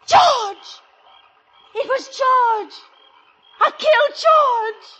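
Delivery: horrified scream of realization; horrified shout. Acted. Realizes she killed husband.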